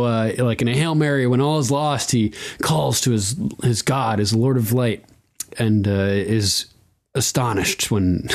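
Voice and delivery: usual speaking voice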